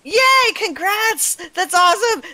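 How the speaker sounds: high, loud gleeful squeal; excited cheering. Pretend. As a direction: gush with over-the-top congratulations. Cheering a friend's soggy newspaper.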